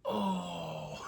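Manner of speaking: high, loud, drawn-out mock "oh"; embarrassed realization. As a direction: imitate dad suddenly realizing his mistake. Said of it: "he's, like, super embarrassed about it".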